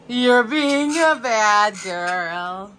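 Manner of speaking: slow, sing-song scolding of a pet; drawn-out mock scolding. Real, not acted. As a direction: stretch every word, teasing.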